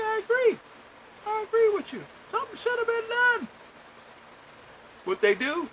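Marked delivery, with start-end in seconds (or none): 0.0-3.5 very high sing-song "I agree"; squeaky, overly agreeable imitation of himself; mock agreeable voice. Telling the phone agent he agrees, in a mock high voice.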